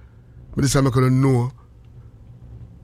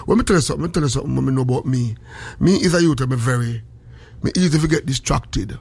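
usual speaking voice

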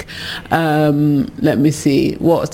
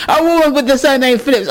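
higher, faster disbelieving repeat; incredulous echo; 9 semitones higher, faster than usual. Real, not acted. Host repeating a listener's jab about her name, incredulous. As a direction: throw the words back in disbelief. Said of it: "what do you mean?".

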